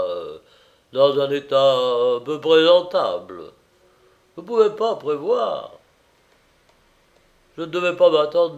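loud, halting tipsy politeness; boozy attempt at formal courtesy; drunken master, apologetic. A narrator's reading. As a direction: grope for proper words while drunk.

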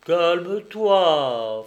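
loud, very drawn-out gruff hushing; gruffly placate, stretching the words; slow, blustering attempt to calm; half gruff, half timid. A narrator's reading. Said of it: "d'un ton moitié bourru, moitié timide".

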